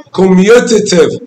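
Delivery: normal talking voice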